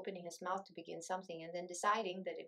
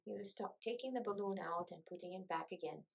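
plain reading voice

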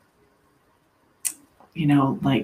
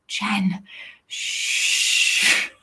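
whispered plea for quiet with a long shush; hushing whisper; 3 semitones higher, 4 dB quieter than usual. Retold or imitated. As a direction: imitate a roommate shushing someone. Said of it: "her finger to her lips".

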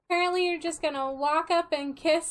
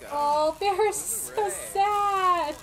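mock pity; 6 semitones higher, 5 dB louder, slower than usual. Pitying sad sim.

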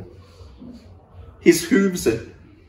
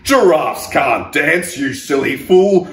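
slow, loud sneering taunt; mocking taunt; 4 semitones higher, 7 dB louder than usual. Animals laughing at the giraffe, slow loud taunt. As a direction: drag it out to mock him. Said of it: "The animals all laughed".

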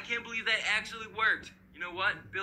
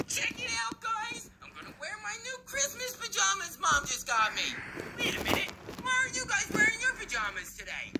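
put-on high, eager child voice turning puzzled; kid brother showing off; 14 semitones higher than usual. Billy shows brothers his new pajamas, proud then puzzled. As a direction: show off proudly, then question them.

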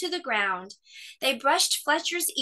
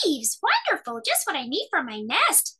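squirrel; 6 semitones higher than usual. A narrator's reading. Squirrel delighted.

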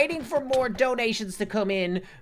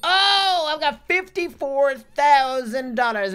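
high, loud, drawn-out whoop of excitement; thrilled outburst; 8 semitones higher, 8 dB louder, slower than usual. Real, not acted. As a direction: stretch it out loud like a jackpot win. Finds a big pile of money.

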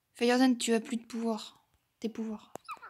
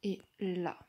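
ordinary talk, nothing marked

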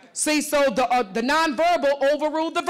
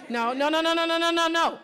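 high insistent no; 6 semitones higher than usual. Real, not acted. Coach stopping student.

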